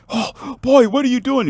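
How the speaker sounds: high-pitched alarmed exclamation; alarmed parent. Acted. Dad going into the water after son, high alarmed cry.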